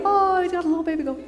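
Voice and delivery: quick, light, fond cooing; cooing shepherd. Retold or imitated. Preacher voicing a tender shepherd, lightly cooing. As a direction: coo over the baby goat.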